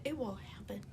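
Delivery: whisper. Pretend. Repeating the whisper.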